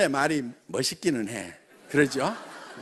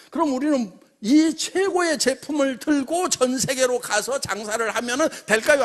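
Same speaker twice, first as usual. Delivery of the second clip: ordinary talk, nothing marked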